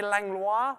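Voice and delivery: Langlois ending in a French "lwah"; French pronunciation. Shown as a demonstration. Host guessing a donor's surname, French pronunciation of Langlois. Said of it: "go with the French".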